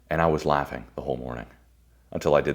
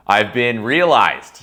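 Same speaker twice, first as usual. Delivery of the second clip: slow, louder I've been realized; proud declaration; 5 semitones higher, 8 dB louder, much slower than usual. Retold or imitated. Speaker tells a stranger he's reborn, slow and loud. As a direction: savor every word.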